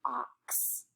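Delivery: "Ox" as a separate F, pause, then OX; segmented word. Shown as a demonstration. Teacher segmenting the picture word fox, segmented. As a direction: sound out the word before "Ox", F then OX. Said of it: "let's segment that".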